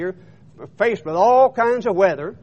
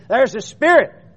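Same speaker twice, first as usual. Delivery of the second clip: mild mock cry of alarm, higher and louder; mock scream; 6 semitones higher, 8 dB louder than usual. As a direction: call it out like a frightened crew, not a full scream. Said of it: "they screamed and said".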